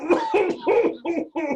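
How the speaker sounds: high, hammed-up exit laugh; theatrical laugh. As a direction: laugh grandly as you storm off. Laughs on storming out.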